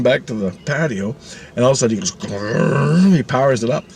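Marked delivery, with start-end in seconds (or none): 2.1-3.2 rolled engine rev-up; mouth-made motor noise; imitated engine growl. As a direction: growl like a helicopter powering up. Imitates helicopter powering up.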